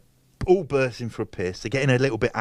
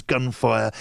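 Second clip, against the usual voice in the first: usual speaking voice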